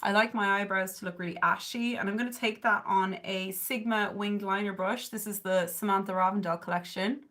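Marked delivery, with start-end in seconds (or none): none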